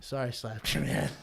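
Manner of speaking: low, quiet sorry; reluctant apology. Retold or imitated. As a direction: imitate a kid made to apologize. Child made to apologize.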